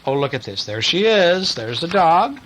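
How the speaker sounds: plain reading voice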